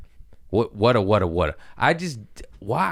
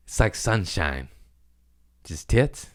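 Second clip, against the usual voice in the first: ordinary delivery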